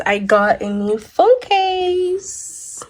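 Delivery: slow, high, thrilled announcement; excited reveal. Real, not acted. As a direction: show off the new thing. Excited reveal of new case.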